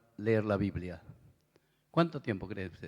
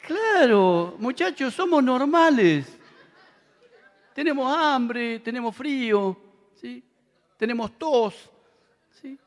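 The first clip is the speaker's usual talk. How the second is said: loud, high, slow preaching; full-force preaching; 12 semitones higher, 9 dB louder, slower than usual. Acted. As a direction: hammer it home to the young men. Predica con toda fuerza. (predicando a todo pulmón, agudo y lento)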